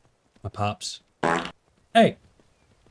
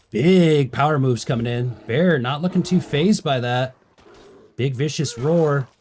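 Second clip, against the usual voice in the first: slow, louder mock fight commentary; sports commentary; 4 semitones higher, 4 dB louder, slower than usual. Streamer narrating guards fighting a bear, mock commentary. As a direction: call the fight like a ringside announcer.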